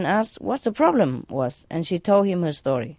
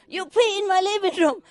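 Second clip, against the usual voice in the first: high scolding yell; yelling at the dog; 14 semitones higher than usual. Retold or imitated. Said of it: "I keep yelling at him".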